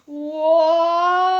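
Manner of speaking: loud, high cut-off "Wha-?"; shocked gasp. Acted. The gems hearing Sans has a girlfriend, loud shocked gasp. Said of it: "the gems said in unison".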